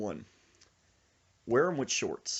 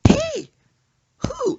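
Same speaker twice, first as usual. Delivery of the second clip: very high, loud squeals, one by one; rhythmic frightened yelps; 14 dB louder than usual. Retold or imitated. Passenger jolted by every bump, rhythmic high yelps. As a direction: yelp on each bump, in rhythm. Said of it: "he goes".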